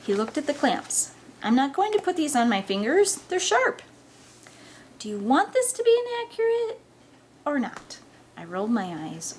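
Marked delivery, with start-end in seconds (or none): 1.4-3.8 mildly whiny refusal, pitch climbing high; whiny protest. Acted. Boy balking at sharp clamps on his fingers, whiny protest. 5.0-8.0 high-pitched exasperated challenge; exasperated retort. Acted. Friend insisting on an accurate test, exasperated.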